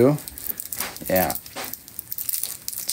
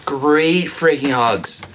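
slow emphatic; 2 semitones higher, much slower than usual. Real, not acted. Boasting about his hugs.

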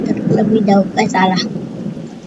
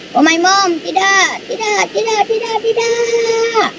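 high-pitched, drawn-out wail of refusal; mock panicked wail; 9 semitones higher, slower than usual. Player wailing as the game plane goes down, mock panic. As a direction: play up the panic, stretching the final word.

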